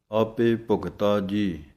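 slow ਆਪੇ ਭੁਗਤਾ ਜੀ ending in a full rest; full stop pause. Shown as a demonstration. Teacher showing the rest after ਆਪੇ ਭੁਗਤਾ ਜੀ, slow. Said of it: "ਇੱਥੇ ਵਿਸਰਾਮ ਹੈ।".

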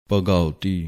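ਭਗਉਤੀ said bha-ga-u-ti, the u sound kept; correct pronunciation. Shown as a demonstration. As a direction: say ਭਗਉਤੀ with a clear u after ga. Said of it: "ਭਗਉਤੀ ਇਸ ਤਰ੍ਹਾਂ ਉਚਾਰਨ ਕਰਨਾ ਹੈ". (ਭਗਉਤੀ ਔਂਕੜ ਦੀ ਆਵਾਜ਼ ਨਾਲ)

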